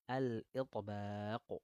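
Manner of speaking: "al-itbaq" stretched very slowly in a changed voice; drawn-out term. Shown as a demonstration. Teacher names the letter trait for the class, very slowly drawn out. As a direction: draw out "al-itbaq" syllable by syllable. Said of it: "Sifat al".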